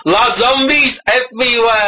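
put-on English in a comic French accent; mock French. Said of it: "he's talking in French".

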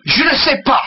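high, snappish French; spat angrily. A narrator's reading. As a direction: spit out the French in a temper. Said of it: "the captain spat angrily".

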